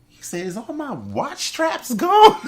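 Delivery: high, slow, laughing disbelief; mock shock. Man finding his watch straps gone, slow high laughing disbelief. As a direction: gasp at the missing straps, laughing. Acting out petty discovery.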